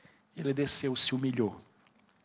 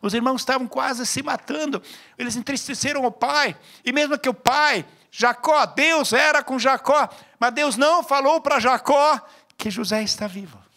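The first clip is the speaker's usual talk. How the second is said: loud, high preacher's call to the church; raised voice hammering the point; fiery preaching; 8 semitones higher, 8 dB louder than usual. Acted. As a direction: drive each phrase home from the pulpit. Preaching at full force.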